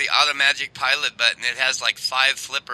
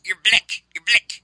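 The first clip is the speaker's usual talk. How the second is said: higher mock South African accent; Joe Pesci; 5 semitones higher, slower than usual. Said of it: "He was doing the South African accent there".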